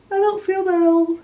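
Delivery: talking through a yawn. Real, not acted. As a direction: let a yawn stretch the words. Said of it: "I don't know why I'm yawning so much".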